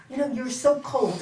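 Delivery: loud complaint; retold accusation. Wife accusing her husband of coldness, loud. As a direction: snap the word cold at him. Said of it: "I used to say to him".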